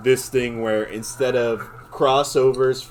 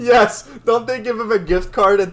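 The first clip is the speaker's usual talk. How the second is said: high, loud burst; sudden excited agreement; excited yes; 12 semitones higher, 7 dB louder than usual. Real, not acted. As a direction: blurt out the yes. Remembering Olive Garden gag.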